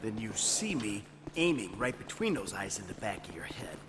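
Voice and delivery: low, soft menacing warning; quiet threat. Acted. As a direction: threaten him under your breath. Veiled threat to nervous partner.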